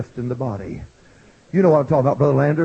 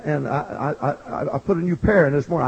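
ordinary talk

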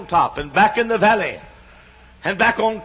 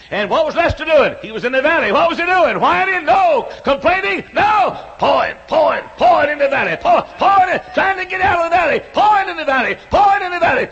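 high, loud revival preaching; fiery preacher calling out to the congregation; preaching at full force; 8 semitones higher, 3 dB louder than usual. Acted. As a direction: build the sermon to a shouting peak. Preaching climax, call and response.

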